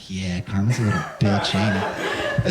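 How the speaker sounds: low-pitched teasing aside; mock-confiding taunt. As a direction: drop the pitch and needle the kid. Teasing the fallen kid afterwards.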